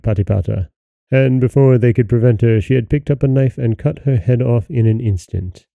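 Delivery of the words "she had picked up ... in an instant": ordinary delivery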